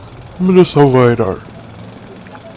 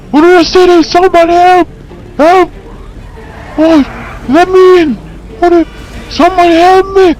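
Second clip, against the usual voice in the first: frantic screams for help; 14 semitones higher than usual. Acted. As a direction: shriek for help as if fleeing an attacker.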